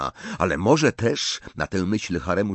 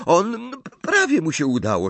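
ordinary delivery, nothing marked

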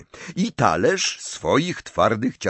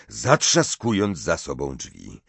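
plain reading voice

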